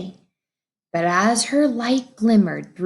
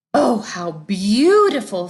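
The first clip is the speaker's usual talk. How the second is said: gasped; 4 dB louder than usual. Acted. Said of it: "Frenzy gasped".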